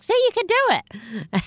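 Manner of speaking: very high, chirpy teacher imitation; encouraging speech teacher. Speech teacher praising a squeaky pupil, high-pitched mock encouragement. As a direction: mimic an over-encouraging teacher. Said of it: "my speech teacher would be like".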